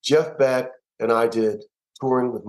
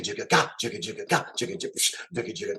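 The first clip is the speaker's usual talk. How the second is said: drum rhythm vocalized; 7 dB quieter, faster than usual. Shown as a demonstration. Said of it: "the way you hit the simple jigga".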